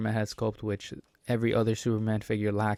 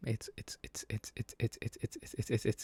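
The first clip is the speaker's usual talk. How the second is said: breathless whisper, stuck on a word; lost for words, whispering; whispered stammer; 8 dB quieter than usual. Real, not acted. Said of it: "let me stop".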